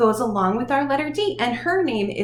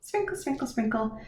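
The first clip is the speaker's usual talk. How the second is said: sing-song sprinkle; 8 dB quieter than usual. Shown as a demonstration. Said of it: "Take a pinch and sprinkle".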